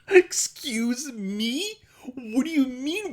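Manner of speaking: high, slow, disbelieving pardon; high-pitched mock bewilderment; baffled surprise. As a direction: act out being baffled by the question. Retold reaction to odd question.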